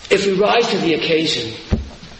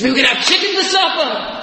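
put-on thrilled child shout; 9 semitones higher than usual. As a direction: shout it like a kid promised a feast. Orphan child thrilled by news.

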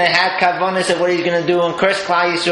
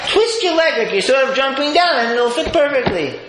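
breezy tailor; 9 semitones higher than usual. Retold or imitated. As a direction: reassure him like a sly tailor. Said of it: "Taylor smiles and says".

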